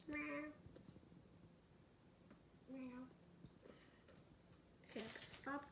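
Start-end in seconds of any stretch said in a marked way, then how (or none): 0.1-2.8 soft kitten meows. Pretend. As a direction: meow like a little kitten. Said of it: "your kitty voice".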